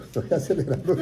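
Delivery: laughing at himself. Real, not acted. Preacher laughs at himself for rushing, laughing. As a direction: chuckle through the words.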